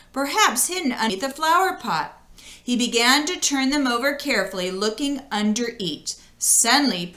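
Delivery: usual speaking voice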